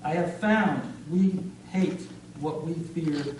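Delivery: emphatic openings falling away at phrase ends; deliberate, punched poetry reading; spoken-word poem. Acted. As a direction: recite it gravely, with weighted pauses. Recites a poem on hate.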